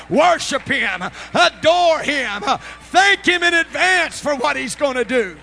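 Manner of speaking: slow, high pulpit shout; very loud, drawn-out revival exhortation; full-force preaching. Real, not acted. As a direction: shout praise to rouse the congregation.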